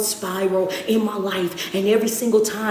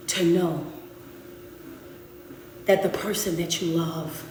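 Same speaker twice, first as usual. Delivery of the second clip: soft consoling; 3 semitones lower, 6 dB quieter than usual. Acted. Coach comforting someone who loved and lost, soft and low. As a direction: ease off and comfort them quietly.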